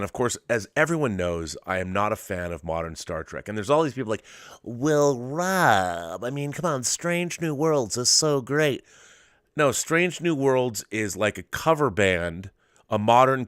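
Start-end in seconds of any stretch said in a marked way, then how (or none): 4.6-8.8 higher, slow, gushing fan voice; mock gushing fan. Mocking fans who praise the new show, in a gushing voice. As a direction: lampoon an overeager fan.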